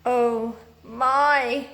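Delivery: a groaning oh my. A narrator's reading. Moonface groans on spotting the house. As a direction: groan it out in dismay. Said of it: "he groaned".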